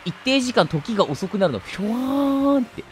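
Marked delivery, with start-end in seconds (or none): 1.6-2.8 slow-motion sound effect. Retold or imitated.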